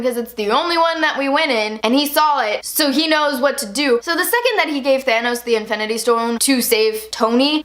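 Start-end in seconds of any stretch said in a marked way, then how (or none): none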